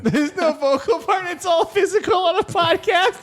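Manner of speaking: high giggling through words; laughing hard. Real, not acted. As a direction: crack up mid-sentence.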